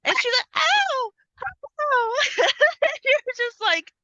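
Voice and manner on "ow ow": mock cries of pain. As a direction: yelp like a broom is hitting you. Victim bonked with broom.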